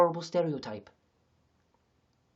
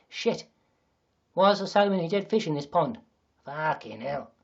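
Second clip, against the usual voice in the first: mock Australian dismay; Australian accent; 3 semitones higher, 6 dB louder than usual. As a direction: exclaim in a put-on Aussie accent. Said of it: "Let me go into Australian".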